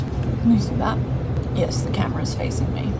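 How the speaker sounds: unvoiced whispered aside; whispering. Real, not acted. Muttered aside to someone off camera.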